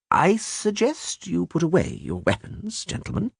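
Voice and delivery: calm, measured gentleman; slow, composed and polite. A narrator's reading. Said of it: "in a measured voice".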